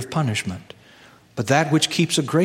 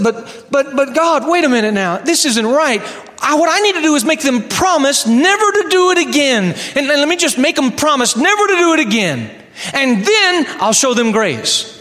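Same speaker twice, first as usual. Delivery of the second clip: high, loud stammering objection; flustered protest; 10 semitones higher, 3 dB louder than usual. Acted. Seminarian arguing with God, high flustered protest. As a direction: scramble for a better plan, indignant.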